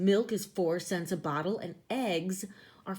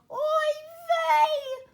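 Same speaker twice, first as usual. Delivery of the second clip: high, loud wail of oy vey; loud, high mock-despairing "Oy vey!"; crying out; 7 dB louder than usual. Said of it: "Mama Gefilte cried out".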